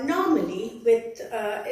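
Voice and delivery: normal talking voice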